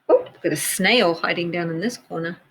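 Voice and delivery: usual speaking voice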